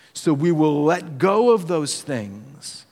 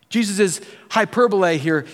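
ordinary talk, nothing marked

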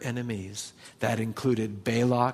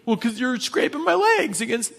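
higher, indignant snap back; angry retort; 10 semitones higher, 3 dB louder than usual. Retold or imitated. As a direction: huff at the donkey, much higher. Balaam angrily answering the donkey.

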